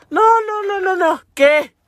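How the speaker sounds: high, loud mock-alarmed outburst; alarmed disbelief. Mother reacting to the phone news, alarmed. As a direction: fire off the no's high, in shock.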